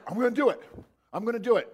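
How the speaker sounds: talking himself into the jump; pumped-up Peter. Acted. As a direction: Peter hyping himself to step out. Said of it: "Peter's like".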